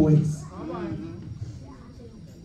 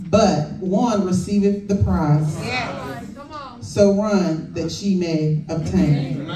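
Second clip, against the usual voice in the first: scripture reading; 6 semitones lower than usual. Acted. Preacher reading Corinthians to the congregation, measured and solemn. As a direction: read it out like holy writ.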